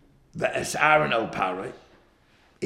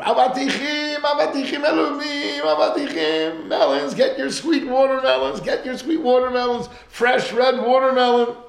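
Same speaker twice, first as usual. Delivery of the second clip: loud market seller's cry, high and ringing; vendor calling out; 9 semitones higher, 8 dB louder than usual. Retold or imitated. Street seller shouting to passersby, loud hawking call. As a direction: hawk the watermelons like a street vendor.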